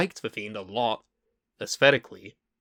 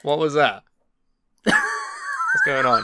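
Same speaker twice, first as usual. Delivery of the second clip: louder, laughing shout of confusion; baffled shout; 5 dB louder than usual. Retold or imitated. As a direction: yell it through a laugh, totally baffled. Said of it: "We all thought".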